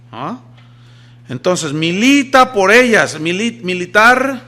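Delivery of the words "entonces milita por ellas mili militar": loud and slow, hammering each word; slow forceful preaching; emphatic command. Real, not acted. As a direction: drive the order home from the pulpit.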